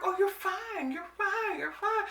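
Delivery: high, breezy brush-off; dismissive doctors. Retold or imitated. Doctors brushing off a worried patient, high breezy mock voice. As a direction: wave the patient away lightly. They always like.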